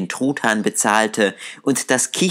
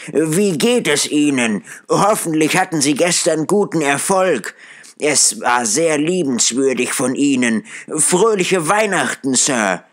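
put-on higher, eager and warmly effusive old man; joyful Scrooge; 6 semitones higher than usual. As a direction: gush your thanks like a changed man. Scrooge greets the gentleman.

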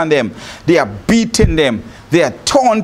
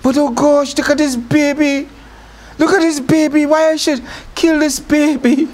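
Pharaoh's daughter doting; 8 semitones higher than usual. Retold or imitated. Pharaoh's daughter gazing at baby Moses, cooing much higher. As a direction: coo high like a princess charmed by a baby. Princess melting over the baby.

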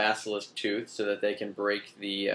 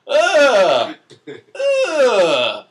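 high, loud hammed-up grunts of frustration; mock frustrated grunt; 11 semitones higher, 12 dB louder than usual. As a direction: grunt like a henchman fighting a wand. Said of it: "the one henchman was like".

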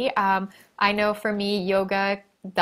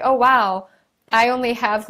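louder, amazed little gasp of a quote; mock surprise; 2 semitones higher, 6 dB louder than usual. Host recalling her symptom list to viewers: louder, delighted surprise. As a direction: act out the pleased discovery, louder. Retells noticing fewer symptoms.

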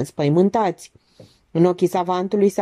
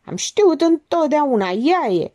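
accusing outburst; 9 semitones higher than usual. A narrator's reading. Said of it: "izbucni Monica".

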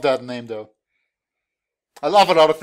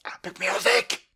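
whispered; 5 semitones higher, 5 dB quieter than usual. Real, not acted. Remarking on the game's music.

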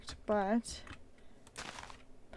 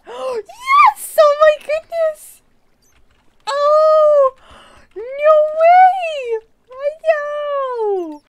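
high loud cheering whoops; triumphant shout; 14 semitones higher, 10 dB louder, much slower than usual. Real, not acted. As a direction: yell with pure triumph. Jump succeeds, triumph.

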